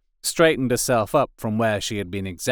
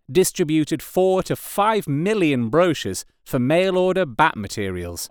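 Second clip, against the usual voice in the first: plain reading voice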